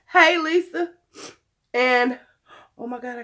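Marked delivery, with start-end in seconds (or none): 0.1-0.9 loud call across the room. Real, not acted. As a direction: call out to Lisa. Calling out to someone nearby.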